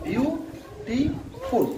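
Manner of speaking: Beautiful very slowly in three separate beats; slow teacher's syllable breakdown. Shown as a demonstration. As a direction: stretch each syllable and pause between. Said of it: "এখানে তিনটি syllable আছে".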